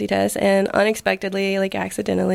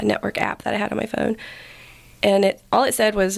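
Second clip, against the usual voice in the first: ordinary talk, nothing marked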